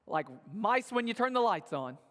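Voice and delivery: ordinary talk, nothing marked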